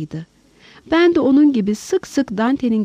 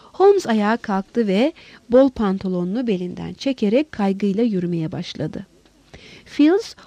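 plain reading voice

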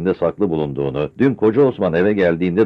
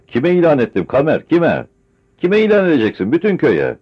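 ordinary delivery, nothing marked

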